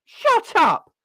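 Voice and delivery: very high, loud and contemptuous; furious shout. Real, not acted. Telling Eddie Howe to shut up, furious shout. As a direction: scream it down. Yelling at Eddie Howe's remarks.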